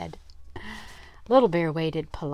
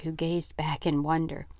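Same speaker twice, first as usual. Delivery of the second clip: ordinary delivery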